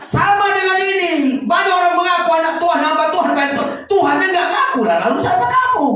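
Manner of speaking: high, loud preacher shout; preaching at full force. Acted. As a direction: preach it hard to the crowd.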